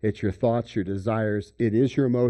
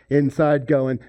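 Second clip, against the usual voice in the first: ordinary talk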